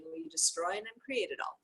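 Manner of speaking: usual speaking voice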